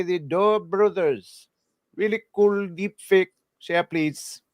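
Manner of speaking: put-on comic high Indian-accented reading. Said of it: "read some super chats in the voice".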